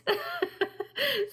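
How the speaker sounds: short high laugh; a bright burst of laughter. Real, not acted. Laughs after husband's "What?.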